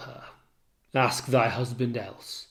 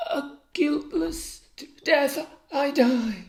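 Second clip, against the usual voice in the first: dying, faint; 12 semitones higher than usual. Acted. Dying Desdemona speaks.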